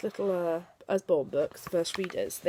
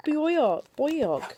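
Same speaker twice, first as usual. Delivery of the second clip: usual speaking voice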